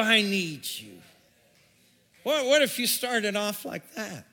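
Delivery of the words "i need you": prayer. Retold or imitated. A believer praying each morning, building into a loud plea.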